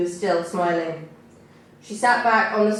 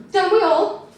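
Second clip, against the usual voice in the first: high, louder sarcastic quip; scoffing retort; 11 semitones higher, 5 dB louder than usual. A narrator's reading. Courtney mocking the worker, high and scoffing. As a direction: toss it off with a sneer. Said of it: "Courtney scoffed".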